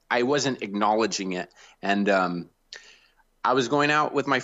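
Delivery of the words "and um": usual speaking voice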